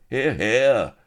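high, loud cheer from the mob; crowd cheer. A narrator's reading. Pirate mob cheering the captain, loud and high. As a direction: cry it out in approval. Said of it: "Cries of".

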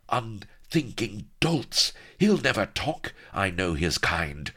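high, slow opening scornful rebuke; scorching pirate queen. A narrator's reading. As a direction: scorch them as a contemptuous pirate boss.

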